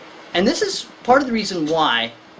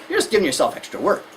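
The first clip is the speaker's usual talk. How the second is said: ordinary talk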